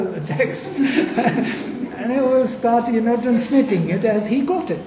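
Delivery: laughing through an amused explanation; amused laughter. Real, not acted. Amused by lone surviving reciter.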